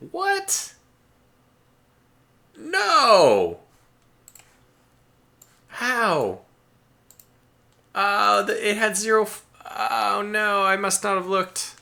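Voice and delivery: real shock at losing the battleship; shocked dismay. Real, not acted. Player stunned by the unexpected loss, shocked high cries.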